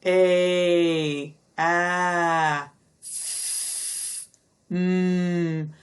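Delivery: "A, a, f, mm" each sound held long; held open sounds. Shown as a demonstration. Teacher demonstrating continuous sounds, each held out. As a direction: stretch each sound out with no stop. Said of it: "they're open sounds".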